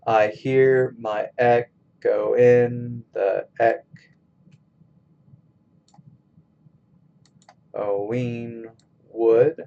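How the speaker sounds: slow, measured, syllable by syllable; scanning a verse. Shown as a demonstration. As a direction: sound out each beat with drawn-out pauses. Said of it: "scan this line".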